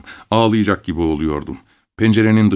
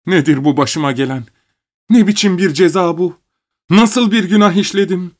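higher and louder, despairing; anguished lament; 9 semitones higher, 4 dB louder than usual. A narrator's reading. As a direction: cry out to heaven in grief. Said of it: "Çok üzgündü". (yüksek sesle çaresiz yakınma)

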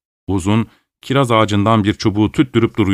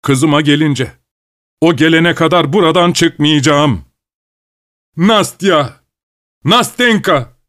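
frantic shouting; 6 semitones higher, slower than usual. A narrator's reading. Frantic father shouting through the house for his daughter. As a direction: shout wildly for your lost daughter. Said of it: "bağırıyor ve çıldırmış gibi".

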